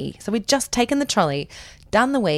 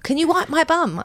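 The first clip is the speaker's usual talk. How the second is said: higher-pitched imitation of a small son; demanding child; 7 semitones higher than usual. Son asking mum at breakfast, demanding child voice. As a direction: mimic a kid asking for help. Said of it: "My son is quite demanding".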